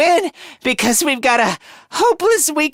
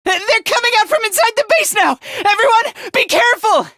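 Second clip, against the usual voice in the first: high, rapid-fire alarm call; urgent warning; 8 semitones higher, faster than usual. Acted. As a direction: warn the team in a high, hurried voice. Warns others of attackers.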